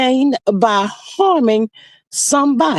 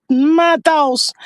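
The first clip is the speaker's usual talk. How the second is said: usual speaking voice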